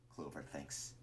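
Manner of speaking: plain reading voice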